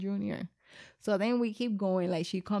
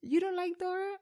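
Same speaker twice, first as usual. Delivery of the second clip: much higher surprised question; mock surprised; 11 semitones higher than usual. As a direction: act shocked at the kid. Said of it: "I was like".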